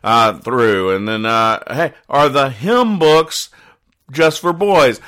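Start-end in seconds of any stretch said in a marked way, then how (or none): 1.7-5.0 put-on kid voice. Kid asking in church.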